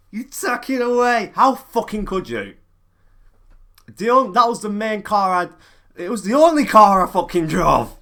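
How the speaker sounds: loud, high angry outburst; furious rant. Real, not acted. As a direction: shout it in a rage. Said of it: "I'm trying to hold the rage back".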